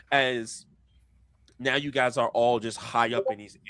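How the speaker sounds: ordinary talk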